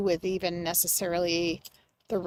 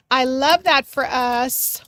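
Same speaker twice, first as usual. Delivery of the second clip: louder, slow and drawn out; slow emphatic cheer; 3 semitones higher, 6 dB louder, slower than usual. Real, not acted. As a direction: proclaim it to the whole group, savoring each word. Repeats phrase, second take proclaimed.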